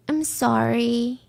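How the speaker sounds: slow, soft apology; repeated apology. Retold or imitated. Suspect asked why she did it, soft drawn-out apology. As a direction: draw it out quietly as if rehearsed. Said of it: "she just kept repeating".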